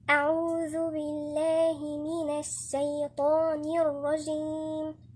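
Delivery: slow, measured tajweed recitation; unhurried, even-toned chanting; Quran recitation. Shown as a demonstration. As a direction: recite it with long drawn-out vowels.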